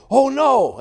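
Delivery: high, loud mock gasp of alarm; a passenger's alarmed "oh no". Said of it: "You know, I go".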